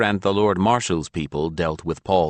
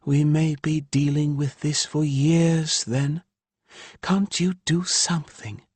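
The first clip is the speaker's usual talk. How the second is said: higher woman's voice, aggrieved; Ilya's voice; 9 semitones higher than usual. A narrator's reading. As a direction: voice the exasperated mother Ilya. Narrator voices Ilya.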